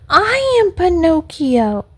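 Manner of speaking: high-pitched, proud announcement; acted excited little puppet; eager puppet. A narrator's reading. Puppet eagerly declares who he is.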